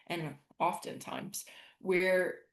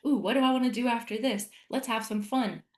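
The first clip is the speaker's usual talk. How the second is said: higher, brighter joyful self-talk; cheerful self-talk, delighted and carefree; happy relief; 5 semitones higher, faster than usual. Retold or imitated. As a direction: sound delighted to be present. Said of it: "And I'm like".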